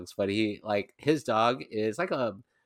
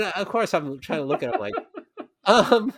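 ordinary talk

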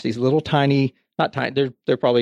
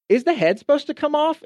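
put-on much higher, small innocent kid's question; worried little daughter. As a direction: ask it like a puzzled child holding a broken doll. Voicing his daughter's worried question.

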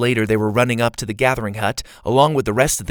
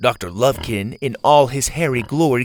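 usual speaking voice

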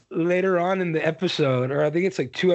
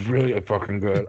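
mouth full; 7 semitones lower than usual. Real, not acted. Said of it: "if you guys hear me chewing".

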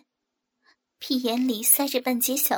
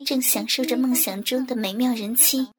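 plain reading voice

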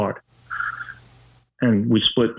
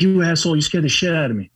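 retold startled yelling, pitched up; angry yell; 6 semitones higher, faster than usual. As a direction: snap at him like you were just scared awake. Wife yelling after midnight scare.

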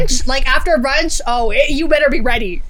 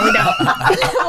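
laughing, pitched-up no; laughing high protest; 10 semitones higher than usual. Real, not acted. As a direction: squeal it out through laughter. Laughing denial in banter.

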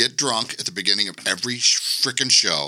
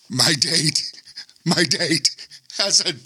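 imitating the guy; 7 semitones higher than usual. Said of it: "he goes".

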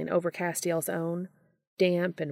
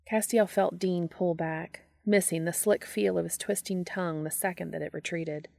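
plain reading voice

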